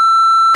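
sudden high laugh; burst of laughter. Real, not acted. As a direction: laugh out loud at the mistake. Laughs at own slip.

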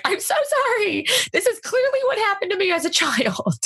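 very high, rushed apologetic voice; frantic apology. Retold or imitated. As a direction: squeak out a panicked apology. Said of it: "I'm like".

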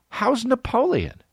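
high, drawn-out comic question; mock eager inquiry. Customer quizzing the time-travelling store clerk, mock eager.

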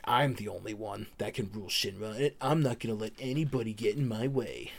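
softly voiced scheming to oneself; understated villainous musing; inner monologue. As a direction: imitate a private thought, kept low-key. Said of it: "has a little inner monologue".

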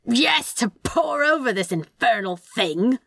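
mild, strained yell, slowing down; harried yell. A narrator's reading. Demon diving for the bed, yelling back harried. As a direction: yell back, starting high. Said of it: "Crowley yelled as the demon dove".

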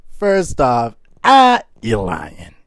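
loud, drawn-out mocking scoff; mocking disbelief. Real, not acted. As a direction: call him a liar, loud. Mocking the lying guy.

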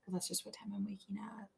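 soft breathy sexy whisper. Real, not acted. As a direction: whisper it like a sultry secret. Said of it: "I like your sexy workout voice".

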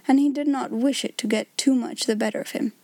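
plain reading voice

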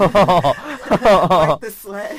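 loud, slow teasing through laughter; laughing tease. Real, not acted. Teasing guest, laughing loudly.

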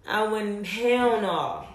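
usual speaking voice

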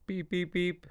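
high electronic-style beeps; imitated beeping. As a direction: mimic a beeper in a high, even voice.

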